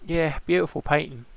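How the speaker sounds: loud real yawn spilling into speech; big yawn, then talk through its tail. Real, not acted. Weary pirate player looking at an old painting, yawning. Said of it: "Excuse me for yawning".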